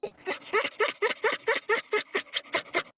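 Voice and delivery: high burst of loud laughter. Real, not acted. Laughs loudly at being stuck.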